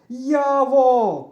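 high, very loud long call; full-throated shout. A narrator's reading. Boy shouting for his lost friend, full-throated. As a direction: holler at the top of your voice. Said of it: "кричу я на повну губу".

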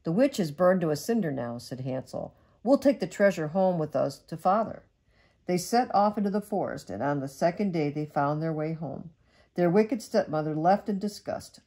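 gentle storybook read-aloud; cosy bedtime story narration; reading to children. Acted. Mother reads bedtime story.

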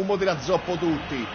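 usual speaking voice

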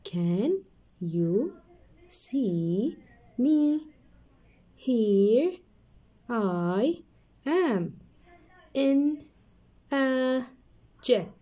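one word at a time, pauses. Shown as a demonstration. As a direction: read each word separately. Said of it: "I'm gonna read it now".

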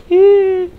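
sudden high yelp; startled yelp. Real, not acted. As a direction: jump at a noise. Startled in horror game.

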